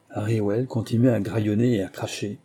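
plain reading voice

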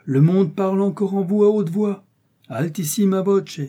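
high, loud clerical outcry; alarmed Jesuit exclaiming, pitch held high. A narrator's reading. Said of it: "s'écria le jésuite".

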